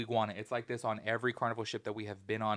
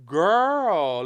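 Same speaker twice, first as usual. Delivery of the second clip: put-on exasperated girl; 6 semitones higher, 13 dB louder than usual. Exasperated retold reaction.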